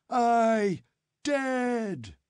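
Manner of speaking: high bloodthirsty mob shout; crowd shouting. A narrator's reading. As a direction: shout it as five hundred men. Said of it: "five hundred bloodthirsty voices".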